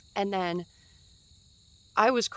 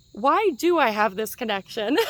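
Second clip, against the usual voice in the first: loud bewildered question; 3 semitones higher, 8 dB louder than usual. Retold or imitated. Retelling her own bewilderment.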